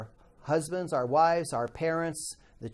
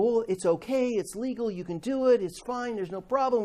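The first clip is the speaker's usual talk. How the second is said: higher, mock-casual brushing off of concerns; breezy excuses; 6 semitones higher than usual. Coworkers arguing it's legal, higher mock-breezy. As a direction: toss off the excuses like it's nothing. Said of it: "people would argue".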